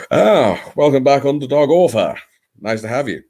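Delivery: high, loud greeting; delighted welcome. Real, not acted. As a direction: call out a welcome to a returning friend. Greeting someone returning.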